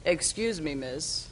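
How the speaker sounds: put-on low, soft old man's voice; older gentleman. Older man politely approaches guest at lunch, low-voiced impression. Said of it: "I'm doing my impression".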